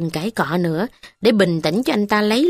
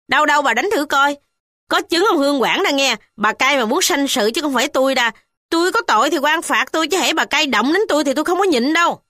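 fast, higher bold challenge; defiant retort; 7 semitones higher, faster than usual. A narrator's reading. Ba Cam defying the mother, fast high retort. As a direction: stand up to her without fear.

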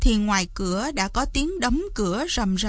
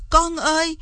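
anguished cry; 6 semitones higher than usual. A narrator's reading. Wretched mother calls to dragon in clouds, anguished cry. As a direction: call him back in misery. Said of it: "Bà mẹ khốn khổ la lên".

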